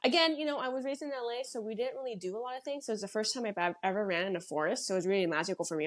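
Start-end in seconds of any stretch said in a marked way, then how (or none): none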